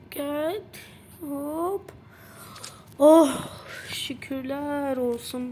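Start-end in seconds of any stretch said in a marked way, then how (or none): 3.0-3.3 loud relieved "oh"; a sudden burst of relief; relieved gasp. Real, not acted. Relief after narrow escape.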